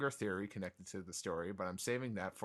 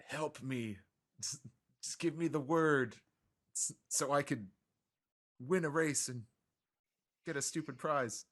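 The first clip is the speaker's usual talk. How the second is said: pleading character voice; 6 semitones higher, 3 dB louder than usual. Acted. Voicing Zunisha begging for help.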